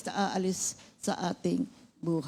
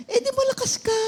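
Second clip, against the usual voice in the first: much higher playful voice; teasing retort; 4 dB louder than usual. Retold or imitated. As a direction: toss it back teasingly.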